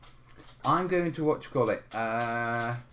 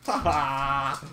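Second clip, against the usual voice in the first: sneering panto villain; slower than usual. Pretend. Gloating laugh after taking cake.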